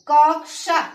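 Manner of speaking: कक्षा said slowly, clear क्ष cluster; careful pronunciation. Shown as a demonstration. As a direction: spell out कक्षा syllable by syllable. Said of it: "सही बोला जा रहा है ना".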